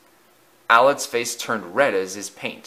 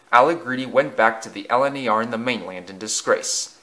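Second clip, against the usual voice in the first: ordinary delivery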